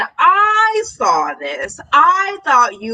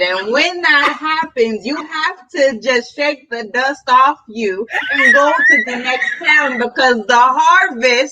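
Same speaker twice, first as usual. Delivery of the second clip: playful church sermon delivery; mock preacher. Pretend pastor teaching the church, mock preaching.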